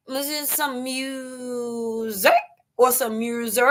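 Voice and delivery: "music" drawn out as "myu-zay", then "myu-zerk"; playing with pronunciation. Shown as a demonstration. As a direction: stretch and twist the word "music". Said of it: "Am I saying right?".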